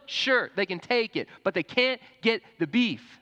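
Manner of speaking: high, loud, outraged refusal as a cow; indignant cow. Acted. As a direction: play a cow protesting at the top of the voice. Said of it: "The cow turns to the chicken".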